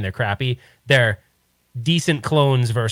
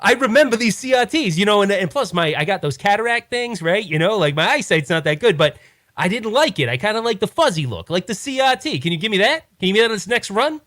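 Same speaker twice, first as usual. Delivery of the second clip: Walmart buyer; 7 semitones higher than usual. Acted. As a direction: play a fussy buyer with bad eyesight, voice pitched high.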